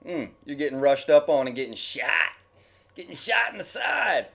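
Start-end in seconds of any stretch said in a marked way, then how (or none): 2.9-4.3 excited gameplay call. Real, not acted. Reacting to gameplay.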